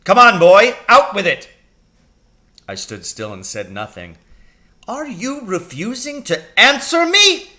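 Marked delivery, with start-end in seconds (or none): 0.0-1.5 loud, high bellow at troops; booming command to a drill squad; parade-ground shout. Acted. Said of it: "He was shouting at me as though I was a platoon". 4.9-7.5 high, loud outraged question; furious shouted challenge. Acted. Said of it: "he shouted".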